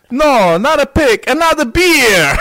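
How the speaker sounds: put-on loud, high, slow German-accented insistence; German accent demand. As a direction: bellow it like a thirsty rock star. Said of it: "He was like".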